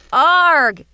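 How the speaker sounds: loud, high pained cry. Acted. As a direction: cry out in sudden pain. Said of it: "he moaned".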